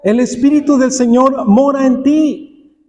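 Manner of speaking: normal talking voice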